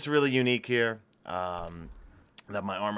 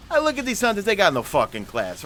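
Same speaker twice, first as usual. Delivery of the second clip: loud, high-starting New York rant; turned-up New York accent; 9 semitones higher, 6 dB louder than usual. Acted. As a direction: hit it hard in a thick New York accent. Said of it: "really turn up the New York accent".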